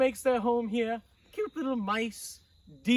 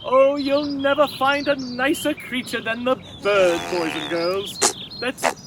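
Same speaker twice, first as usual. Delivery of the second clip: calling out loudly over the outdoors; big loud kids' host, pushing higher; 5 semitones higher, 7 dB louder than usual. Acted. Kids' show host calls out loudly to boys and girls.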